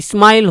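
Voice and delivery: স্মাইল with an extra i in front, "ismail"; wrong pronunciation. Shown as a demonstration. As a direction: add a short i before the s of স্মাইল. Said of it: "হচ্ছে না কিন্তু". (স্মাইল-এর আগে ই বসিয়ে "ইস্মাইল")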